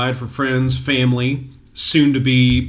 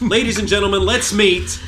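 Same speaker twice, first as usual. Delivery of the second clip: higher announcer intro; 6 semitones higher than usual. Acted. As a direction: introduce her like a show host. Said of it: "Best announcer voice so far".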